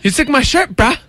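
high-pitched put-on bro voice; acted dude sauntering up, high and rising; mock bro greeting. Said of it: "the dude walks up and went".

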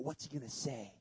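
hushed wondering. Acted. Imagined crowd thought, hushed.

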